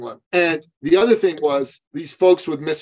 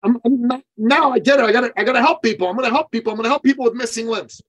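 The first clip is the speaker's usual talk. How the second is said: higher, quick, eager mock voice; excited client; 10 semitones higher, faster than usual. Client excitedly telling coach his plan, higher and fast. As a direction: pour out the new plan.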